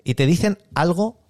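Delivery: ordinary talk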